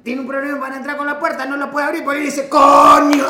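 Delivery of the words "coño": loud imitation of a frustrated curse; exasperated swearing. Imitating partner cursing at a door that won't open, exasperated shout. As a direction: burst out, mimicking someone fed up.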